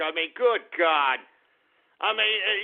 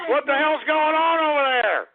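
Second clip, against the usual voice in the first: alarmed demand; 7 semitones higher, 4 dB louder than usual. Real, not acted. Host calls into overheard shouting match.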